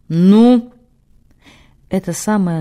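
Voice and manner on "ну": sharp loud bark; barked demand. Acted. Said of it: "владыка гаркнул так".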